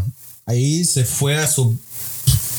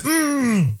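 high, squealed mmm sliding down; exaggerated yummy hum; savouring mmm; 12 semitones higher than usual. Imagining spicy chorizo salsa, hammed-up savouring hum.

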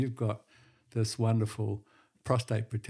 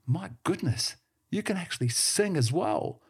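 higher surprised blurt; 7 semitones higher than usual. Acted. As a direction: blurt it out amazed. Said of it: "blurted the businessman".

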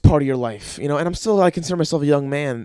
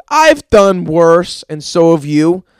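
slow loud boast; 8 dB louder, slower than usual. Acted. Boasting punchline to listeners.

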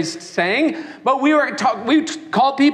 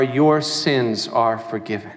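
tender; 12 semitones lower than usual. Acted. Jesus speaks to the woman.

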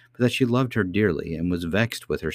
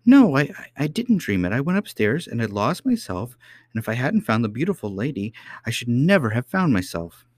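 high, urgent little princess; child princess, protesting; 7 semitones higher than usual. A narrator's reading. Princess to nurse who says she dreamt it, urgently protesting.

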